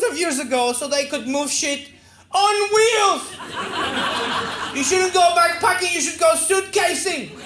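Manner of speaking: high, loud comic outrage; worked-up, high-pitched tirade; indignant rant. Acted. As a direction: rant at full pitch in exasperation. Ranting at his younger self's idiocy.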